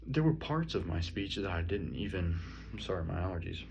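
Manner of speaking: normal talking voice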